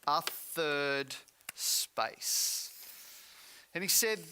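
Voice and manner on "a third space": slow reveal. Retold or imitated.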